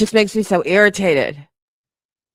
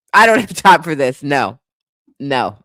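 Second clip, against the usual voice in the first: ordinary talk, nothing marked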